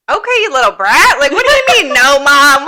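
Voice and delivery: high, loud playful scolding of a little brat; mock outrage. Aunt mock-scolding her toddler niece, high and loud. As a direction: squeal it like you're mock-offended. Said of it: "And I was like".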